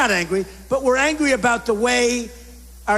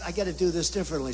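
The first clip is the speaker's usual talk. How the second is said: quiet aside; 6 semitones lower, 9 dB quieter, much faster than usual. Retold or imitated. Muttered to self.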